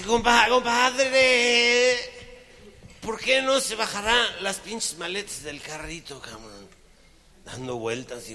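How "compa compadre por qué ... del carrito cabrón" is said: put-on high, loud, slow drunken call; slow high drunken slurring; drunk slur. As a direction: drag it out like a drunk. Drunk friend slurs at carousel.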